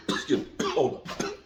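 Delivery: clearing a dusty throat; coughing on dust. Real, not acted. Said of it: "Got some dust in my throat".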